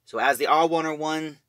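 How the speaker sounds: normal talking voice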